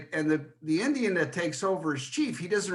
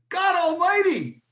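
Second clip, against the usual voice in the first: loud, much higher mock yell of pain; startled yell; 14 semitones higher, 6 dB louder, slower than usual. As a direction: yelp it out like someone jabbed with a pencil. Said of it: "she yells".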